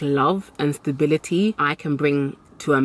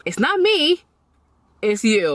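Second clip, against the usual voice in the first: sassy realization; 5 semitones higher than usual. Retold or imitated. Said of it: "I see what it is now".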